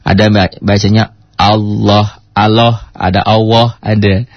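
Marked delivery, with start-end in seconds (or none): 1.4-3.8 "Allah" with the second syllable as a rounded oh-woh; wrong vowel in Allah. Shown as a demonstration. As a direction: mispronounce "Allah" with a heavy rounded o. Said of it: "yang nggak paham".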